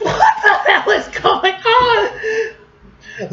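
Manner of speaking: high, slow laughing question; laughing disbelief. Real, not acted. Laughing at arm wrestle challenge.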